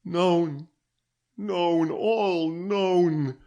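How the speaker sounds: anguished moaning lament. A narrator's reading. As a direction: let it fall out in hopeless repetition. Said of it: "was her moan".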